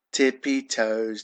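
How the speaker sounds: ordinary delivery, nothing marked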